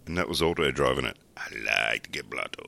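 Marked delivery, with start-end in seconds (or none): 1.3-2.7 low, soft cartoon bus-driver voice; lightly voiced cartoon stoner bus driver; Otto. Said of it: "I'm pretty good at doing the Otto".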